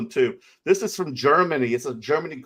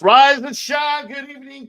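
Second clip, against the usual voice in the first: rise-and-shine shout to a chat viewer; excited shout; 12 semitones higher, 8 dB louder than usual. Real, not acted. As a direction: belt it out like a morning alarm. Greeting live chat viewer.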